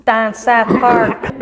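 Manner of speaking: put-on playful, lightly done Pittsburgh accent; mock Pittsburgh accent. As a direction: stretch it out like a Pittsburgher. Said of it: "when I do my Pittsburgh accent".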